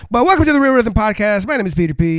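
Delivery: loud upbeat show intro; podcast host intro. Acted. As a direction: announce the show with energy.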